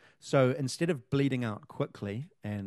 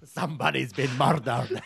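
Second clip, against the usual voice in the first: laughing; 5 dB louder than usual. Real, not acted. Laughing after murder bit.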